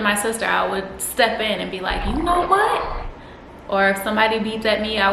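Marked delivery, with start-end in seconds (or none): 2.1-3.1 indignant, pitch climbing high on a drawn-out challenge; mock angry. Snapping back at whoever yelled at the sister, mock angry. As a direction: act out squaring up to someone, high and slow. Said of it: "You know, angry".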